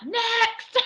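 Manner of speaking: high, sing-out dismissal; mock a casting director calling out, pitched high; dismissive director. Casting director dismissing an auditionee, mock high call.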